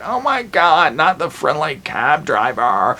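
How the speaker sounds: high, slow, drawn-out dismay; shocked gasp. Retold or imitated. Viewer horrified the cab driver gets grabbed, high and slow. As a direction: let it rise in disbelief, stretched out.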